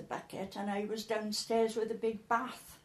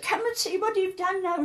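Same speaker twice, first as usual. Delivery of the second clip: higher, louder indignant summons; raised-voice mock telling-off; retold scolding; 9 semitones higher, 6 dB louder than usual. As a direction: mimic an exasperated neighbour at the door. Neighbour's retold scolding at door.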